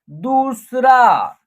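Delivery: दूसरा said slowly with दू held long; long vowel. Shown as a demonstration. Teacher joining letters for learners, दूसरा drawn out. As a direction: stretch the ऊ in दूसरा. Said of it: "आप long तरीके से उच्चारण".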